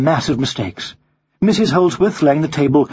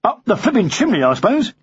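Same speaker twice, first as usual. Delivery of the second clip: raised-pitch sarcastic policeman; 8 semitones higher than usual. A narrator's reading. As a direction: scoff as a doubting policeman. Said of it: "said the policeman sarcastically".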